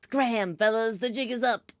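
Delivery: lightly voiced mobster bug; bug gangster voice. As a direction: bark it like a tiny crook. Bugs flee toilet, GM voices them.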